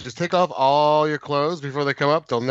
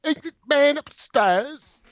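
higher, slow mock landlady voice; 10 semitones higher, slower than usual. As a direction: ham up the shrill innkeeper's wife. Mimicking the screaming landlady.

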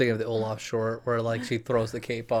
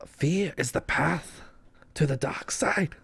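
put-on slow, breathy old Yoda; whispered wise old master; a hint of Yoda, hushed; 4 semitones higher, 5 dB quieter, slower than usual. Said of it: "What did Yoda say?".

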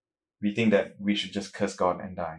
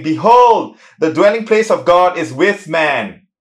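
booming, proclaiming voice, louder and higher; loud voice from the throne; 4 semitones higher, 8 dB louder than usual. A narrator's reading. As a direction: call it out like a voice from heaven's throne. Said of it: "I heard a loud voice from the throne saying".

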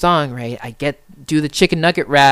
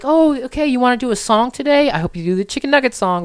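excited mom; 8 semitones higher than usual. Retold or imitated. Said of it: "She's like".

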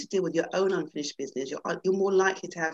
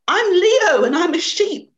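high, loud, slow sheep-like bleat; mock bleating reply; 12 semitones higher, 12 dB louder, slower than usual. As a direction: bleat it like a proud little sheep. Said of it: "Leo bleated".

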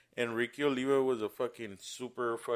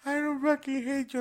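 much higher, yelling denial; shrill upset mother; 3 dB louder than usual. Retold or imitated. The drunk guy's mom denying it, high and upset. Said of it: "she'd be like".

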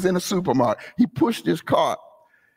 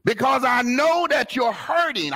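ordinary delivery

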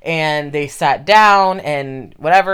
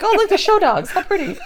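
cooing spectator voice. Retold or imitated. Mock dog show spectator.

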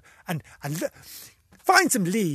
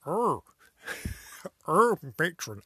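Kenneth Williams; 5 dB quieter, slower than usual. Retold or imitated. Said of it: "doing an impression of Kenneth Williams".

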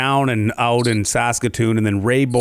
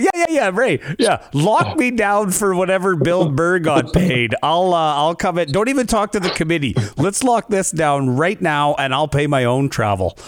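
high, begging to lock it down; mock-frantic, jumping at the deal; desperate eagerness; 6 semitones higher than usual. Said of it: "and I was like".

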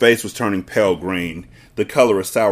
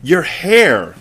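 high, loud horrified outburst; shocked shriek at the husband; 8 semitones higher, 5 dB louder than usual. A narrator's reading. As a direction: shriek it as the appalled wife. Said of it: "the mother was shrieking".

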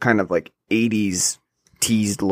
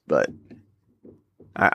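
ordinary talk

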